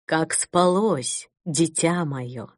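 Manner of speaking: soft, slow, low bishop's voice; quiet, ingratiating. Acted. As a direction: purr it gently, too sweet to trust. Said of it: "Тихий, вкрадчивый голос Конрада".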